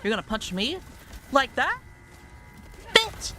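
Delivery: rising taunt ending in a yell; mock tough guy escalating to shriek; building to a shout.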